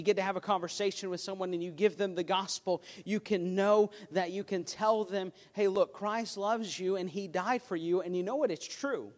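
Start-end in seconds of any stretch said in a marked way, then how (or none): none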